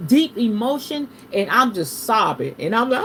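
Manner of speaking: normal talking voice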